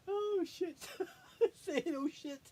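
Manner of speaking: high, breathless laughing fits between words; can't stop laughing, gasping for air; helpless laughter. Real, not acted. Reliving a memory, laughing until crying. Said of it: "crying from laughing".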